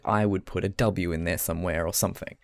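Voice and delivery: ordinary talk, nothing marked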